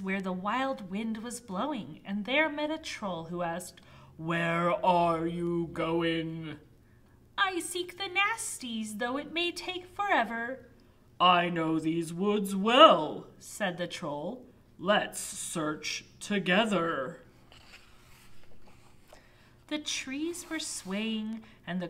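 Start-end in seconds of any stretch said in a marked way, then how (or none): 4.2-6.6 slow deep troll voice. Acted. Troll asks in the woods. 7.4-10.7 high knight voice. Acted. Knight answers troll. 11.2-13.3 slow deep troll voice. Acted. Troll offers help. 14.8-17.2 slow deep troll voice. Acted. Troll offers help.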